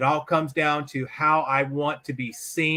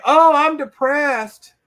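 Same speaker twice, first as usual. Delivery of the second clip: high, slow, whining complaint; louder, drawn-out mock whimper; whiny man; 9 semitones higher, 8 dB louder, much slower than usual. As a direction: moan it out like a sorry sad sack. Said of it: "I don't have time for a whiny man".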